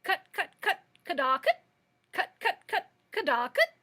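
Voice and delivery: high, loud clucking hen cry, cut cut Cadaquit; shrill hen cackle. Acted. A hen bursts out from under the throne, loud high cackling. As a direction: squawk it out like a startled hen. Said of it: "a shrill voice suddenly cried".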